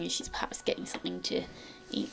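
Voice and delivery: ordinary talk, nothing marked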